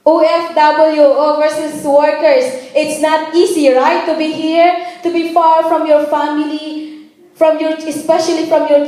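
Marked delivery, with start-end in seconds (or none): none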